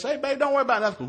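high, quick reassuring holler; loud, high-pitched re-enacted yell; yelled downstairs. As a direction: call down the stairs to your wife. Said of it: "so I yelled downstairs".

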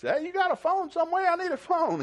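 high, loud call to a far-off farmer; hollering across a field. Retold or imitated. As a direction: yell out for help from the road. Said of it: "he hollered at the guy".

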